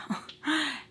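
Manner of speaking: quick high laugh, quietly; a brief awkward laugh; short embarrassed laugh. Real, not acted. Laughs at the tight grip.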